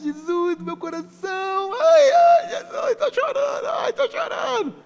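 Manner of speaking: very high, slow, whiny wailing; mock weepy believer. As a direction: ham up an overemotional fan crying out to Jesus. Mocking emotional believer. (fiel exagerado chorando, voz fininha de deboche)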